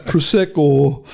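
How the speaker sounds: slow, loud mock Geordie; hammed-up Geordie, stretched out; Geordie accent. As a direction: draw the word out in Geordie. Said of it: "it's that kind of".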